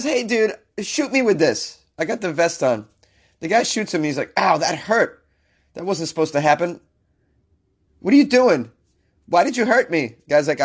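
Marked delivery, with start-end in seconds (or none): 0.0-2.9 lightly voiced eager fool, pitched up; mock cocky dare. Podcaster playing the man asking his neighbor to shoot him, mock eager. Acts out the man requesting the shot. 4.4-10.2 mock hurt indignation. Podcaster imitating a man upset at being shot by his buddy, mock indignant.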